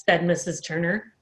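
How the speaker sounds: usual speaking voice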